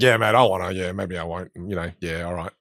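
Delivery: lazy Australian slang. Shown as a demonstration. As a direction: mumble it like a laid-back Aussie, fading out. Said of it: "we shorten all of our words".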